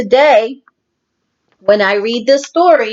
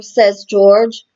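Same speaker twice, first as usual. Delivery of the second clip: ordinary delivery, nothing marked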